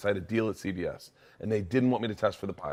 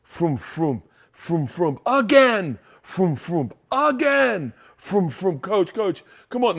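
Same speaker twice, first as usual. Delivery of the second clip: players skating drill; 8 semitones higher, 6 dB louder, much slower than usual. Retold or imitated. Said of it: "and they go".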